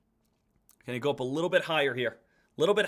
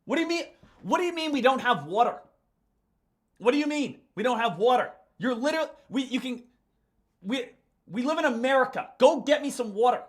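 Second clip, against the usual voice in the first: higher, exasperated complaining; a touch of comic outrage; mock indignation; 7 semitones higher than usual. Real, not acted. Complaining to Claire about having no water, exasperated.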